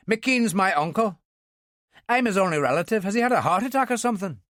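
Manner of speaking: an Ulsterman hearing bad news; worried Ulster man. A narrator's reading. Dillon worried about his uncle.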